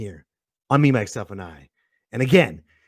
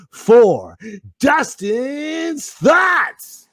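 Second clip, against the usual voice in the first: announcer intro; 8 semitones higher, much slower than usual. Acted. Announcing segment.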